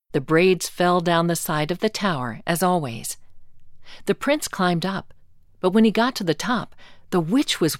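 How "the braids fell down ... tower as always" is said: ordinary delivery, nothing marked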